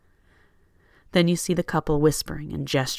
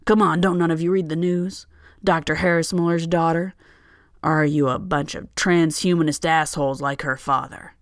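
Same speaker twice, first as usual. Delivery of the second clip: put-on louder, belligerent drunk heckling; drunk angry rant; 5 dB louder than usual. Drunk man berating the passengers, loud slurred anger.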